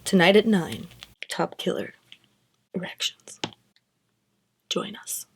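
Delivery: hushed dramatic news promo; news teaser. Pretend. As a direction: tease tonight's story, dropping to a whisper. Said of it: "I sound like a news anchor".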